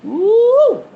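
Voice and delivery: high, loud "woo"; excited woo. Real, not acted. Reacting to the spells talk, excited whoop. Exclaims over what she reads.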